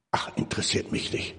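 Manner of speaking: muttered, breathy shrug of a line; mock dismissive. Preacher mimics a scornful person, muttering dismissively. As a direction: toss it off like it doesn't matter. Dismissing holy things with contempt.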